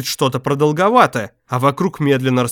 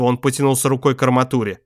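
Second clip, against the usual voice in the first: ordinary delivery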